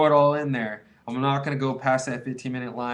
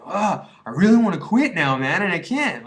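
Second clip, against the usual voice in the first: higher, louder, exasperated complaint; fed-up outburst, louder and higher; frustrated groan; 6 semitones higher, 8 dB louder than usual. Retold or imitated. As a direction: vent the frustration with a loud ugh. Frustrated at himself relapsing.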